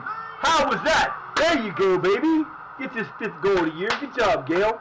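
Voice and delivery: high and loud, cheering the goal; excited cheering. Real, not acted. Gamer cheering his player Gale's goal, excited and loud. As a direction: celebrate it like a fan on the couch.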